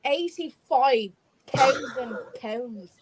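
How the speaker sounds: slow, drawn-out mock Irish narrator; Irish accent. Showing off her practiced Irish accent, slow. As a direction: savour each word in an Irish lilt. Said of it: "That was all right, actually".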